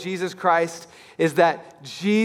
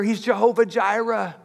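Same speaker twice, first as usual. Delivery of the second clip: usual speaking voice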